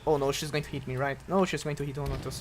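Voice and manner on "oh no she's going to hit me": ordinary talk, nothing marked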